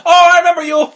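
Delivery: mock-cheery recognition; sushi lady's voice. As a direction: pop up brightly as the lady remembering him. Retelling the sushi lady.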